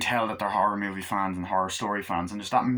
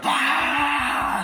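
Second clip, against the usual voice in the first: mock scream; 6 dB louder than usual. Spiders burst out.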